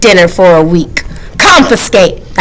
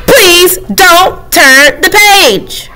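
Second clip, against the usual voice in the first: slow, higher, each word spelled out; deliberate slow plea; very clearly; 9 semitones higher, much slower than usual. Acted. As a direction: lay out every word of "Please don't turn the page" slowly. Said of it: "I'll say it once more, very clearly".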